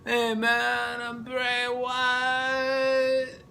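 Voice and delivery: high, very slow drawn-out creepy voice; mock creepy cult leader; Bray Wyatt impression. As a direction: stretch every word like Bray. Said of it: "that's how he sounds".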